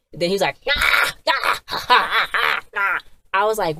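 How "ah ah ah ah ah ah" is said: high, loud mock-evil ah ah laugh; a hammed-up villain laugh, pitched high; villain's cackle.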